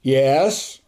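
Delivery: lightly officious one-word answer; imitating an official reply; official upperclassman tone. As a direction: answer like a senior cadet on duty. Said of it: "very official upperclassman type tone".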